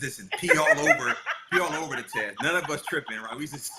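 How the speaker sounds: laughing through the words. Real, not acted. Husband recalls pee on the tests, laughing. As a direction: crack up while telling it.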